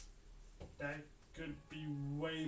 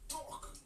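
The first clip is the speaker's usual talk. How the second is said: imitated thud; 12 semitones higher, 4 dB quieter than usual. Imitates toe hitting table.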